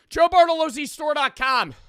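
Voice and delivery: loud, high-pitched web address plug; shouted announcement. Real, not acted. Streamer sending viewers to the merch store, shouted announcement. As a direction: belt out the store name.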